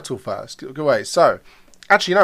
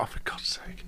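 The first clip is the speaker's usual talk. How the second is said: exasperated whisper; 17 dB quieter than usual. Retold or imitated. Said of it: "she's like".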